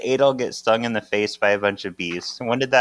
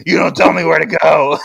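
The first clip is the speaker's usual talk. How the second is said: loud, hammed-up angry shout; 4 semitones higher, 8 dB louder than usual. Ben snaps at Prue for giving him orders, hammed-up angry. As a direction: bellow it in mock outrage. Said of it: "So angry".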